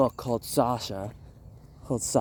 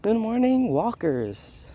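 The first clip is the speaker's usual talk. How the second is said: show host intro; 7 semitones higher than usual. Real, not acted. Opening greeting of the show.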